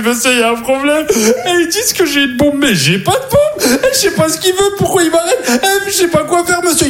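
high-pitched, panicky phone plea; panicked pleading. Acted. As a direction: plead frantically, voice climbing high. Panicked phone call acted out. (supplication paniquée, voix aiguë)

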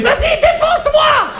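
slow, high hammed-up shriek; girlfriend screaming. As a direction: squeal it out in mock ecstasy.

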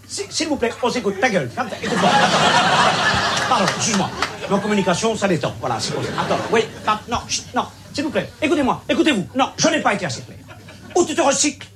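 from strained pleading to a furious high yell; the calm expert snaps into a high, loud rant; furious shouting. Acted. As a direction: lose it completely and scream at them. Expert loses temper with the audience.

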